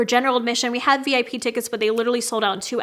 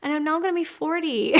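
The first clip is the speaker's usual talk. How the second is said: higher, breaking into a laugh; amused disbelief; 4 semitones higher, 3 dB quieter than usual. Real, not acted. Vlogger telling viewers she's nearly forty, laughing disbelief. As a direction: let the laugh take over at the end.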